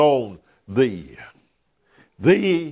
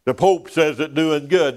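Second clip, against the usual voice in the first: ordinary talk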